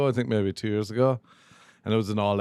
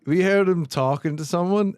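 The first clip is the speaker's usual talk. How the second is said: usual speaking voice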